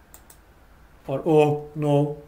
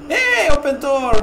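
high, loud demand; frantic raised-pitch call; urgent shout; 10 semitones higher, 7 dB louder than usual. Real, not acted. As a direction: yell to be let through. Shouting at a door in game.

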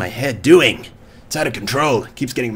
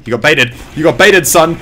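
taunting; 2 semitones higher, 6 dB louder than usual. Real, not acted. Taunting baited enemy.

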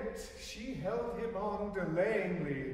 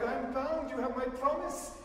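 crying out; 8 semitones higher than usual. A narrator's reading. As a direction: exclaim the promise, pitched up. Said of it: "she cried".

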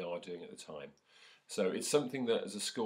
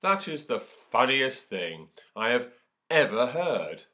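loud, slow lion's laughing roar; lion mocking a tiny mouse, loud; roaring with laughter; 5 semitones higher, 12 dB louder, slower than usual. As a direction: bellow it as if the idea is a joke. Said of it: "roared the lion with laughter".

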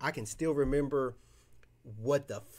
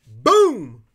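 much louder exclamation; real excited shout; 4 semitones higher, 19 dB louder than usual. Real, not acted. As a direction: punch it out loud. Reacting to a card pull.

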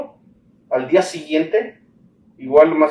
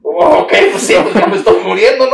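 laughing disbelief; 9 semitones higher, 5 dB louder than usual. Retold or imitated. Told he's moving to a ward.

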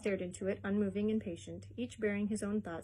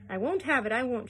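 loud, higher, indignant outburst; wife erupting in indignation; angry cry; 3 semitones higher, 8 dB louder than usual. A narrator's reading. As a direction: blow up after he leaves. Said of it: "she cried".

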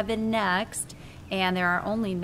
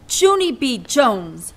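loud, high shout of a name; 4 semitones higher, 9 dB louder, slower than usual. Acted. Teacher shouting at Junie B. across the room, loud. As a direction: call the child out sharply. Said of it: "shouted Missus".

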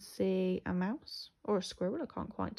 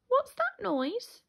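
high, slow mouse squeak; squeaky; 5 dB louder, slower than usual. A narrator's reading. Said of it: "he squeaked".

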